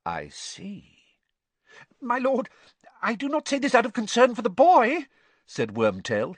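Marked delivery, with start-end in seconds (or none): 0.0-1.2 low, soft, cold reply; softly breathed. A narrator's reading. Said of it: "breathed the second voice softly". 2.0-5.1 very high, loud, hurried squeak; rising squeakily. A narrator's reading. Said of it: "his voice rising squeakily".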